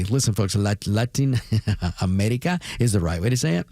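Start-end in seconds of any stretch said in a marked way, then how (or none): 0.6-2.6 America with a Spanish tapped R; Spanish-accented Latin America, chuckled through. Shown as a demonstration. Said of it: "I roll my R's or I say it correctly".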